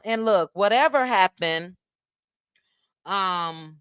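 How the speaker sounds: ordinary talk, nothing marked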